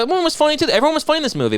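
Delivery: high, sing-out mock quote; high-pitched mimicry. Man quoting what she said about the movie, high mocking imitation. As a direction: parrot her remark high above your own voice. Mimicking a woman's earlier remark.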